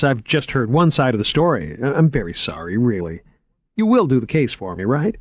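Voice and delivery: keen curator showing off his finds; eager curator. A narrator's reading. Curator showing Margot the vaults, lightly voiced eager man. Moriarty explains and apologises.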